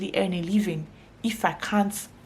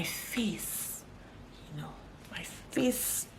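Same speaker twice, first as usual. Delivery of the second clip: whispered aside; 12 dB quieter, much slower than usual. Real, not acted. Aside about her expressive face.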